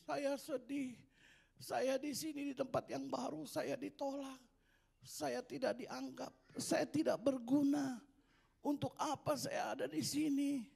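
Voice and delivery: sad little dog. Acted. Crying little dog complains.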